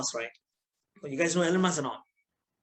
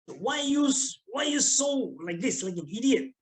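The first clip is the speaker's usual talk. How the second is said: mock scolding lecturer; 5 semitones higher, slower than usual. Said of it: "one of my lecturers scolded me".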